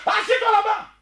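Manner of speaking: sharp, high shouted order to sit down; scolding shout. Real, not acted. Live host orders a critic to sit down, shouting. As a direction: bark it like a scolding elder.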